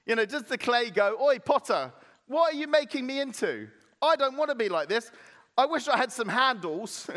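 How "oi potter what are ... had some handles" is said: high, loud mock protest; indignant complaint. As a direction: play an affronted lump of clay. Clay acted out complaining to potter.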